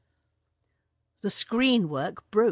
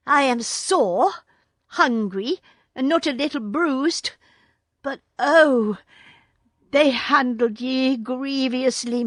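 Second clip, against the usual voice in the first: put-on higher, louder boyish voice; eager boy, worried for his friends; young Mowgli; 6 semitones higher, 4 dB louder than usual. A narrator's reading. Mowgli tells the bear and panther he is bruised, eagerly.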